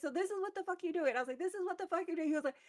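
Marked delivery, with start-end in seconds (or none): 0.0-1.2 high, fast mock outrage; retold angry confrontation. Character pulls up on him dancing, retold angry confrontation. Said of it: "I screamed his name". 1.4-2.3 high-pitched mock outrage; retold angry confrontation. Character pulls up on him dancing, retold angry confrontation. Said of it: "I screamed his name".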